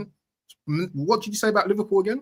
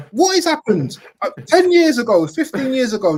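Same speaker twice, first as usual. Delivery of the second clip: louder, higher exasperated rant; exasperated outburst; 6 semitones higher, 6 dB louder than usual. Real, not acted. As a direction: throw your hands up at modern fans. Exasperated rant about fans.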